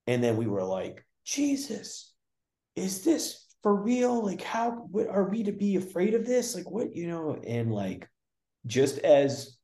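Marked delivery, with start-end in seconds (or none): 1.2-7.4 imitating a frightened cry. Retelling the session to the host, imitating their frightened alarm. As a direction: act out the panicked disbelief.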